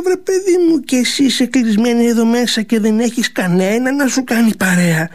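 lightly voiced sly old maid; coaxing old servant. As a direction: tempt the girl sweetly.

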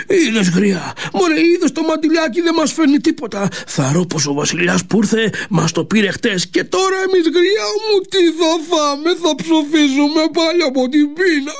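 put-on high, wailing old man's complaint; whiny old man. Ο γέρος παραπονιέται στη γριά. (γκρινιάρης γέρος, ψηλή κλαψιάρικη φωνή)

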